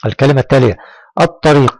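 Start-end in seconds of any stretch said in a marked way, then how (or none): 1.2-1.8 الطريق with no L, a doubled emphatic t; silent lam. Shown as a demonstration. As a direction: go from "a" straight to t in الطريق. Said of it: "لم تنطق اللام". (نطق الطريق دون لام)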